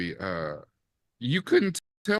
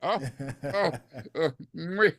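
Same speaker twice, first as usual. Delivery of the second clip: high, laughing bravo with a chef's kiss; rapturous applause; 5 semitones higher, slower than usual. Retold or imitated. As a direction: gush like a fan on his feet. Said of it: "I almost stood up in my chair like".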